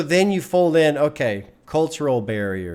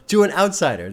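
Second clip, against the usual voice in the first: ordinary delivery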